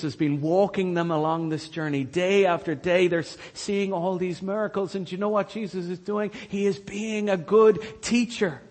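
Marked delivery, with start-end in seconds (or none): none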